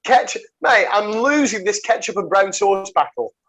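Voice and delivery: usual speaking voice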